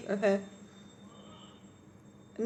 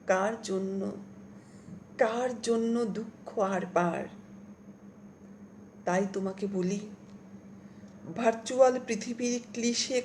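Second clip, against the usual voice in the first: slow, measured poetry reading; poem recitation; slower than usual. Acted. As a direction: let each line hang with long pauses. Recites own poem aloud.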